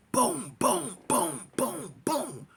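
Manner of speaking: mouth-made gunfire booms; gunshot sounds. Retold or imitated. Narrator mimicking gunfire in the chase, voiced booms. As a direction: imitate the shots.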